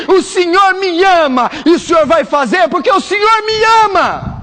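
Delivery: loud, high shouted prayer; bold prayer. Shown as a demonstration. Pastor shouting a bold prayer to teach the congregation. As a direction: cry it out boldly to the Lord. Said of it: "eu vou te ensinar uma oração".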